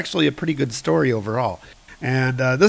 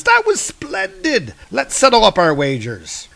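excited Hermes; 10 semitones higher than usual. Acted. Hermes excited over the fight, hammed-up high delight. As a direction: gush it in a high put-on voice. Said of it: "Hermes is pretty excited".

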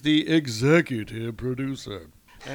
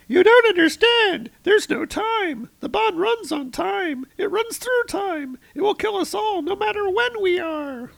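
very high, squeaky frantic voice; panicky Shadrach; 7 dB louder than usual. Acted. Shadrach warning the librarian, high frantic squeal. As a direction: shriek it like a panicking tentacle god. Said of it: "And the Shadrach is like".

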